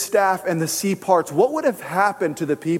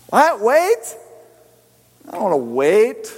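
high, incredulous mock protest; whiny little kid; 4 semitones higher than usual. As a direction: protest like an impatient child. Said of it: "We're like a bunch of little kids".

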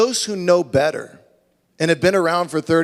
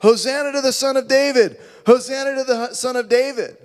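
high chant, pitched up; children shouting; 9 semitones higher than usual. Retold or imitated. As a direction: cry it out like excited kids. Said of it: "started shouting".

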